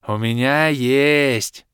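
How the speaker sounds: put-on slow, drawn-out happy childlike voice; joyful announcement. A narrator's reading. Orbit happily offering his own bottles. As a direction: announce it with glee. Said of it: "радостно оповестил нас Орбит".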